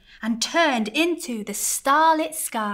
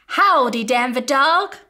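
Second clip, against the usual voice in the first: cried out; 3 semitones higher, 8 dB louder than usual. Acted. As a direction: call out the greeting to the dog. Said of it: "cried Cowboy Baby".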